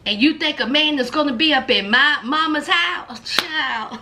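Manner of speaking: usual speaking voice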